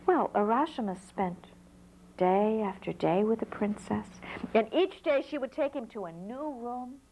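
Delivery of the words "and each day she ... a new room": usual speaking voice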